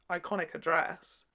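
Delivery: ordinary talk, nothing marked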